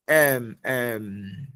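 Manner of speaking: ordinary talk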